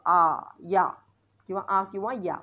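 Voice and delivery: आ and या with open "aa". Shown as a demonstration. Teacher showing how A can sound, demonstrated vowels. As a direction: sound out आ, then या, each clearly separate. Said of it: "एचा उच्चार आ असा होतो".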